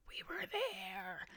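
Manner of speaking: hushed, drawn-out raspy whisper; imitating a voice gone to a raspy whisper; really raspy voice. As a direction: croak it out slowly, with almost no voice. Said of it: "I say in my really raspy voice".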